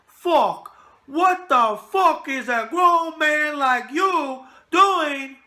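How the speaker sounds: loud, high mock Irish shout with drawn-out words; Conor McGregor impression. Host showing viewers how a fighter swears, loud mock Irish accent. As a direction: bellow it slowly in an imitated Irish accent. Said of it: "he doesn't say it the way I say it".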